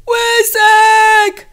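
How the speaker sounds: loud, very high, long-held scream; crazed, drawn-out yell; frantic last-strength cry. A narrator's reading. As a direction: call the name with everything left. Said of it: "woła ostatkiem sił i szarpie się obłąkany".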